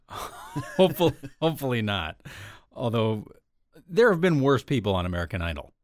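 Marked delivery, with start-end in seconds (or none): 0.1-1.1 breaking into a laugh on the word. Real, not acted. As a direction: chuckle it out, amused. Laughing at co-host's joke.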